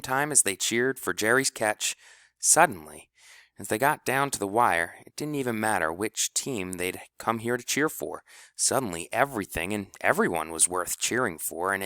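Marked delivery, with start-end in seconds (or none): none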